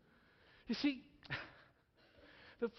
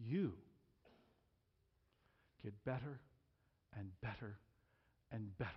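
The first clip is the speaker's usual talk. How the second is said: quieter, lower and unhurried; soft, gentle reflection; 8 semitones lower, 4 dB quieter than usual. Real, not acted. Preacher closing the sermon, soft and low. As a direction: ease them down gently.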